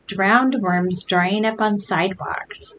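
ordinary delivery

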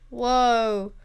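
normal talking voice

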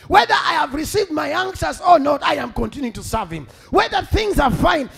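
loud, driving declarations; rousing preacher, hammering each phrase; full-force preaching. Acted. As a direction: build a defiant vow before the church.

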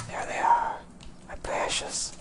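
hushed, gloating whisper; creepy whisper. Pretend. Hungry player finding his precious crops, creepy whisper. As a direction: whisper it like a hoarder over treasure.

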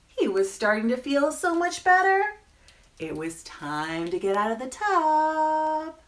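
animated reading with rising, stretched suspense; children's story narration. A narrator's reading. Storyteller reading the penguin's bath time, animated narration. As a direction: lift and stretch the phrase endings for children. Reads picture book to children.

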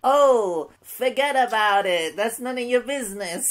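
loud, high, hammed-up Italian American; Italian American accent. Comic delivering an improvised punchline, hammed-up Italian American accent. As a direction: belt it out as a cartoon Italian American. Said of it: "Why did the Italian American".

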